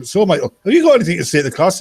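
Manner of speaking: a hint of classroom teacher, brisk; teacher addressing class. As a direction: imitate a teacher prompting the new pupil. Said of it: "And teacher, like, introduces him".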